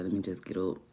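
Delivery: normal talking voice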